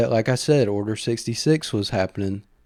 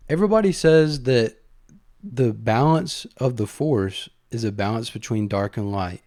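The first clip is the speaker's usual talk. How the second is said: normal talking voice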